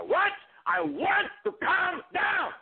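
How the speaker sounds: sudden high, slow bellow about calming down; explosive shout. Acted. Pacino impersonator screaming to his host that he wants calm. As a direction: blow up yelling that you want calm.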